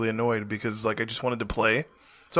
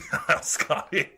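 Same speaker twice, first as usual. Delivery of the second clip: laughing; 3 semitones higher, much slower than usual. Real, not acted. Mocking, laughing.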